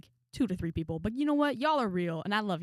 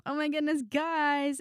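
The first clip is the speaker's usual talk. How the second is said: much higher, pitched-up oh my goodness; exasperated exclamation; 8 semitones higher, slower than usual. Real, not acted. To viewers about the coaching staff, pitched-up dismay. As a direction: throw it up high in disbelief.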